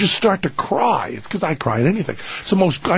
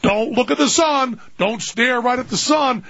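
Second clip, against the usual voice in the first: mock nagging warning; 7 semitones higher than usual. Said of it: "walking up and down the beach going".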